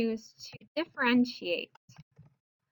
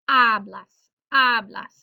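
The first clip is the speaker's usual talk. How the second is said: "hablas" with a loud, punched first syllable; stress on first syllable; 9 dB louder, slower than usual. Shown as a demonstration. Teacher demonstrating you speak, "hablas" stressed on first syllable. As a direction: hit the "ha" of "hablas" hard. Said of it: "second to last syllable".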